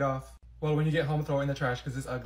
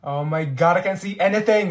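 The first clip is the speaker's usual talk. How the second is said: frustrated outburst; 8 dB louder than usual. Acted. Frustrated in class.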